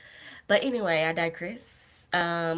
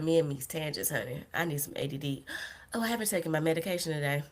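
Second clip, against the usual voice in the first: ordinary talk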